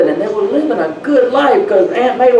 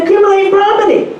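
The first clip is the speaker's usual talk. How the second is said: high, quick, put-on aunt voice; imitating Aunt Mabel; 11 semitones higher, faster than usual. Quoting Aunt Mabel's advice, a high mimicking voice. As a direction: mimic her way of saying it, pitched up. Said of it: "She talked like this".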